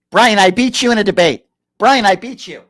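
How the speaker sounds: taunting, a hint of a gloating rival; mock gloating at Brian, cigarette in mouth; mocking Andrew Wilson. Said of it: "This is Andrew Wilson".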